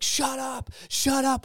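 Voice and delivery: high, pushed "shut up"; retold yell. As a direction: yell it at the pastor, pitch way up. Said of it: "why am I yelling at this man".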